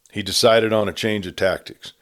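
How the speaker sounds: ordinary delivery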